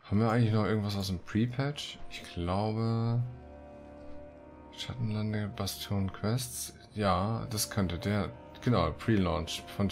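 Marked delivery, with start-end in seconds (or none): none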